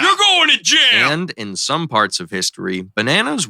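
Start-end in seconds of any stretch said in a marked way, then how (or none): 0.0-1.1 gleeful shout. Acted. Monkey skit voices.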